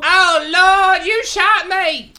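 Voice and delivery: very high, loud, slow wail; hammed-up melodramatic accusation; mock wailing victim. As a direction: holler to the heavens in mock agony. Acts out shot mother-in-law.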